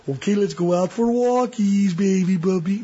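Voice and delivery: high, sing-song puppy coo; mock baby talk. Owner cooing to puppy about a walk, high baby voice. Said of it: "you can't be assertive when you're like".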